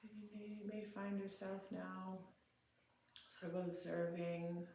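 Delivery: quiet, spacious meditation leading, phrase by phrase; guided meditation. Real, not acted. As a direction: settle into a hushed, unhurried meditative prayer.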